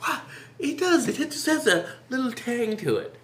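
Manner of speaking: high, light mock voice of the brother; imitating brother. Brother at dinner praising the chicken, mock high voice. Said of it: "my brother would be like".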